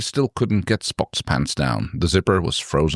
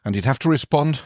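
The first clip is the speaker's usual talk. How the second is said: ordinary delivery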